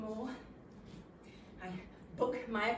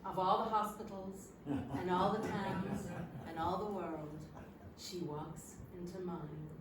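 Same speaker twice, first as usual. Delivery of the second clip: romantic film quote; 5 semitones lower than usual. Acted. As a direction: savour it like a film hero. Man quoting a film romantically.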